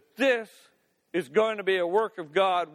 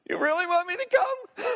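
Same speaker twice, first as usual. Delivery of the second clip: much higher, incredulous squeak; mock disbelief; 12 semitones higher than usual. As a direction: Peter can't believe Jesus means it. Playing Peter's disbelief.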